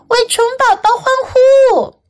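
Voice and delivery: high storybook cheer; cheering crowd. A narrator's reading. Everyone celebrates baby bug, cheering. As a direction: cheer for the little hero. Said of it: "大家都叫了起来".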